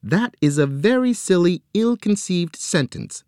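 high, prim lecturing voice; imitating Nancy. Joe mimicking sister Nancy, high and prim. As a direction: put on his sister's tone. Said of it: "My sister Nancy would say".

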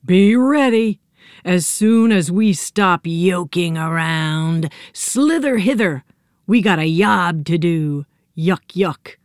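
drawn-out villain voice, relishing the threat; menacing yolk creatures. Acted. Egg yolk invaders gloat at Sybil Hunch, slow alien menace.

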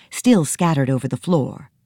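ordinary delivery, nothing marked

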